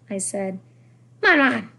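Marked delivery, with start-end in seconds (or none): 1.2-1.7 loud, higher, reproving call; stern. A narrator's reading. Son about to lecture his mother, stern. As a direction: pull her up firmly. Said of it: "Dad said sternly".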